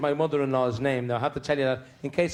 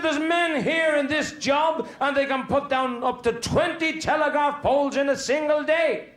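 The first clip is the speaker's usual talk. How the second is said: high-pitched indignant foreman outburst; exasperated foreman; 11 semitones higher than usual. Acted. Foreman berates a worker over poles, high-pitched exasperation.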